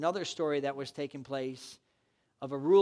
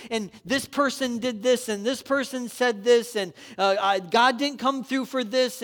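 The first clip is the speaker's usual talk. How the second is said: loud, high exhortation; full-force preaching; 8 semitones higher, 10 dB louder than usual. Acted. Pastor exhorting the congregation to stand fast, loud preaching. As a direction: drive every line home to the crowd.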